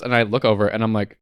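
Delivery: normal talking voice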